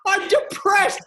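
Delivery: can't keep a straight face saying it; laughing. Retold or imitated. People laughing at depressed line.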